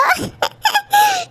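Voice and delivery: high giggle at the friend's news; teasing giggle. Acted. Friend laughs at the kiss demand, giggling.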